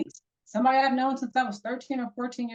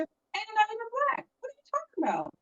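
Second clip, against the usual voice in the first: much higher, mock-baffled retort; incredulous; 11 semitones higher, 3 dB quieter than usual. Woman quoting her reply to Brad, incredulous. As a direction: sound utterly bewildered.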